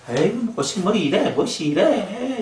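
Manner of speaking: 높고 느리게 투덜대며 깎아내려; 못마땅해 느릿하게 투덜거려; 시큰둥한 불평. Retold or imitated. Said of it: "이렇게 했잖아요". (꽃을 깔보며 높고 느리게 투덜거림)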